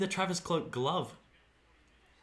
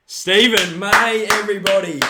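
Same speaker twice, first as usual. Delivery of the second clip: announcer hype; 6 semitones higher, 14 dB louder than usual. Real, not acted. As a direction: present him to the crowd like a ring announcer. Hailing a player like an announcer.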